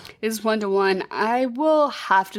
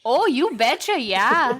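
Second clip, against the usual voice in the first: high, lilting mock Minnesota accent; folksy Minnesota caricature, swooping up and down; 4 semitones higher, slower than usual. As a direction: do an exaggerated cheery Minnesota accent. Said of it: "talk like that in Minnesota".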